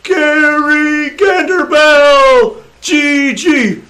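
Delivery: high, slow, loud drawn-out cheer; crowd yelling. Acted. Gnome fans yelling Gary's name, loud and drawn out. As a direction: belt the name like fans in the stands. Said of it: "some gnomes over here start yelling".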